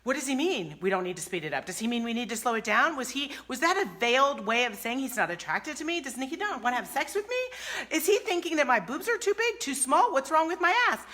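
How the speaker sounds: mock spiralling worry, question piled on question; acted anxious overthinking. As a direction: spin out into panicked self-doubt. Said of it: "her brain is going".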